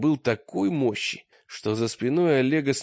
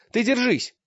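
hurried shout; 11 semitones higher than usual. A narrator's reading. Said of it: "крикнул Таргитай торопливо".

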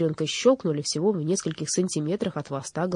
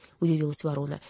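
ordinary delivery, nothing marked

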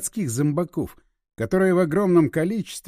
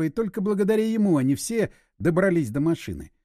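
ordinary delivery

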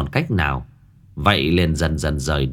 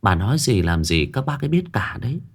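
ordinary delivery

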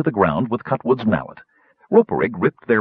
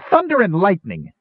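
angry cry; 9 semitones higher than usual. A narrator's reading. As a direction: let rip in rare gnome anger. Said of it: "he cried".

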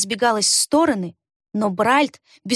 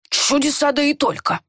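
put-on higher, amazed old man; marveling; 5 semitones higher than usual. A narrator's reading. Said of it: "подивился Бархольд". (изумлённо, восхищённо)

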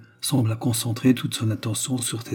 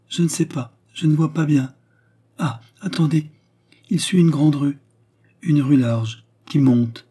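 put-on higher-pitched child sleepwalker voice; entranced child seer; 4 semitones higher, 4 dB louder than usual. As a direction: report the vision haltingly, pitched up. Child somnambulist reporting a vision.

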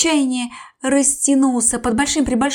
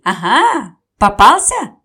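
loud jeering "gotcha"; loud mocking triumph; gloating shout; 9 dB louder, slower than usual. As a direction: crow it out at the trapped crocodile. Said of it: "Одни кричали".

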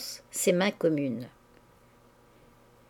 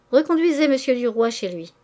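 raised, higher call to the driver; 4 semitones higher, 4 dB louder than usual. A narrator's reading. She calls out to her coachman, raised voice. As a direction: call it out over the carriage noise. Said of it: "elle cria à son cocher".

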